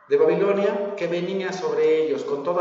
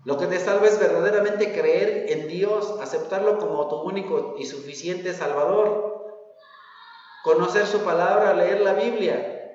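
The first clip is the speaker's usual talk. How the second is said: ordinary delivery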